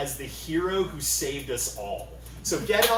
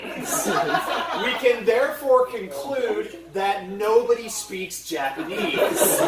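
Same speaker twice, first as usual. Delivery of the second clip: mock conclusion; 5 semitones higher, 4 dB louder, slower than usual. Mock-logical conclusion punchline.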